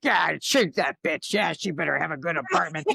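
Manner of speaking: a petulant ego griping about going out; whiny ego voice. Retold or imitated. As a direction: complain about the cold like a spoiled brat. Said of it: "We're like".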